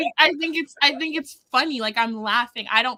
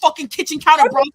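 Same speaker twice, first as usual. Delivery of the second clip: loud, aggressive British shouting; Gabe Kidd impression; 8 dB louder than usual. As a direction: yell it like an angry British wrestler. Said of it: "do the Gabe".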